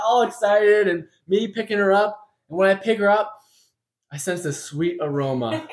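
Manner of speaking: barely holding back laughter at his own joke; telling his fantasy through bursts of laughter; laughing through it. Real, not acted. Comic fantasy of homecoming, laughing.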